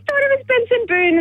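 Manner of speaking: high-pitched imitation of fangirls; excited girls. Caller imitating two girls who spotted the star, excited.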